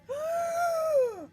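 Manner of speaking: loud, high dramatic gasp; huge sharp inhale. Acted. Dragon taking a huge breath before fire, loud gasp. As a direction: suck in a giant breath.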